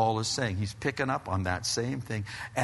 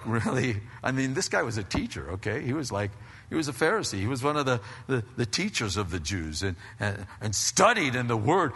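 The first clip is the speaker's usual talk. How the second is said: ordinary delivery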